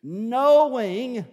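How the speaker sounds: knowing rung out high and loud; loud swell on knowing. Real, not acted. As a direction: lift and belt the word knowing. Preacher swells one scripture word.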